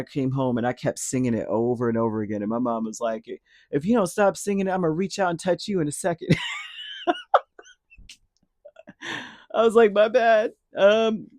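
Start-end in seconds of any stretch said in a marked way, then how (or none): 3.7-6.3 put-on higher, faster mom telling off a child; mock scolding mom. Mom warning a child who keeps singing, mock scolding. Mom's scolding voiced in story. 6.4-7.4 high, squealing burst of laughter; real laugh. Real, not acted. Storyteller laughing at mom's threat, real high laugh. 9.5-11.3 amused retelling. Real, not acted. Amused retelling after big laugh.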